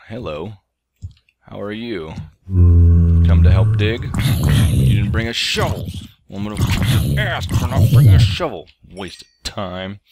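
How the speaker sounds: a loud, halting put-on voice, not far from his own; mock the lady's way of talking like kids once did; imitating how she talks. Dad showing viewers how the lady talks, mildly mocking. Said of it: "that's actually how she talks".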